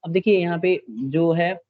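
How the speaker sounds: ordinary talk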